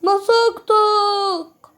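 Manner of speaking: high, loud and very drawn out; a slow, loud, gloating "مسكتك"; gotcha. Real, not acted. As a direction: stretch it out in triumph. Catches opponent in hide and seek.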